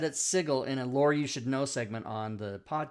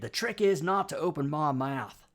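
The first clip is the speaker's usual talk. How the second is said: hammed-up Australian accent; Australian impersonation; mock Australian accent; 4 dB louder than usual. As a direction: quote it in a broad mock Aussie voice. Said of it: "my exposure to the Australian accent".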